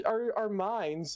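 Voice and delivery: normal talking voice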